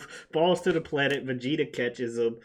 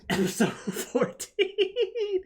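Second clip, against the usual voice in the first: high, slow, laughing through the title; much slower than usual. Real, not acted. Host announcing the next episode, laughing. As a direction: giggle out the episode number, stretched.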